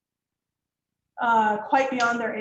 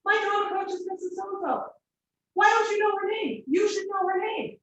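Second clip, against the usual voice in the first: put-on indignant girl; 9 semitones higher than usual. Girl to teacher who doesn't know her coach, high indignant outrage. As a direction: act baffled and insulted at the question. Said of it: "She looked at me like she's gonna end my life".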